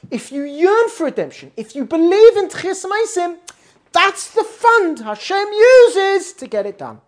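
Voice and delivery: high, loud, ringing exhortation; impassioned preaching. Real, not acted. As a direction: build to a slow, soaring proclamation. Preaches on yearning for redemption.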